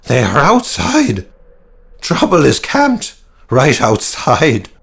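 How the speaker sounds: slow, high, dread-filled cry; panicked warning. Acted. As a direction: drag the words out in terror. Frightened sergeant warns of danger outside.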